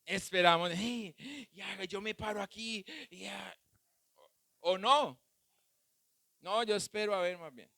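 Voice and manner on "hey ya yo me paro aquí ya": much softer resigned settling-in; a hint of a lazy believer, much softer; mock lazy waiting. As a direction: say it like someone giving up and sitting down. Quoted passive waiting believer.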